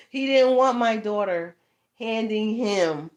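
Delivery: ordinary talk, nothing marked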